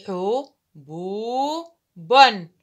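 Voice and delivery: حو، بو، بن stretched very slowly, last one loud; teacher reading a word slowly; word in syllables. Shown as a demonstration. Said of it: "سوف أقرأ الكلمة".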